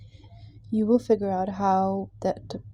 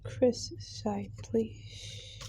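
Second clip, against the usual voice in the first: whispering; 7 dB quieter, much slower than usual. Real, not acted. Whispered request to spirits.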